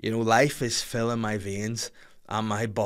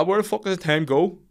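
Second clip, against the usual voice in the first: a light impression of his old dad, higher and a bit louder; 8 semitones higher, 5 dB louder than usual. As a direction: grumble it like an ageing father. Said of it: "hate to sound like my old dad".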